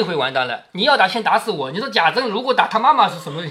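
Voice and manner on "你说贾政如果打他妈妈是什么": ordinary talk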